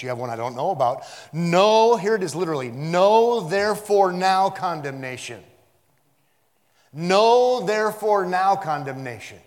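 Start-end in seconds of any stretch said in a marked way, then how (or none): 1.3-2.0 "Know" shouted high and very loud; emphatic. Shown as a demonstration. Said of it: "It's for emphasis". 2.7-9.4 "Know" shouted high and long, each word weighed out; emphatic. Shown as a demonstration. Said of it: "It's for emphasis".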